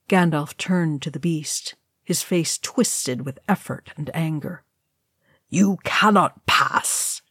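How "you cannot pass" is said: slow, grated warning; grating. A narrator's reading. Wizard turning to face the beast, grating. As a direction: grate it out slowly, barring the way. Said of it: "he grated".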